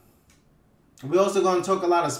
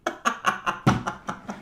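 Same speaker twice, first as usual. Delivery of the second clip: unvoiced, wheezy chuckling; helpless laugh at your own joke; laughing at himself; 5 dB quieter than usual. Real, not acted. Host cracking himself up, wheezy laugh. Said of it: "I make myself laugh".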